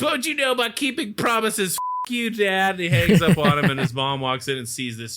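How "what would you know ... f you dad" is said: put-on high, whiny kid retort; bratty kid. Son hanging up on his dad, high whiny retort. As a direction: sneer it like a bitter son. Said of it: "he goes".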